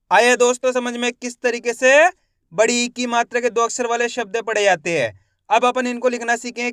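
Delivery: ordinary talk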